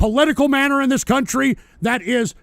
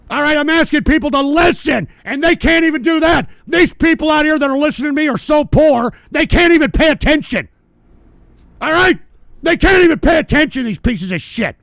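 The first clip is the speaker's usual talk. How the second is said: louder, raised angry rant; 4 semitones higher, 4 dB louder than usual. Real, not acted. Host berating poor listeners, loud and angry. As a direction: rail at them, voice climbing.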